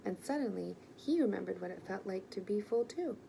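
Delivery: usual speaking voice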